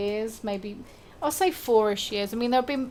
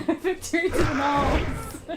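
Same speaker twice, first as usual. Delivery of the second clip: high, slow cheer through laughter; mock triumph; 6 semitones higher, much slower than usual. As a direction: proclaim it, drawn out and laughing. Echoes the mock victory cheer.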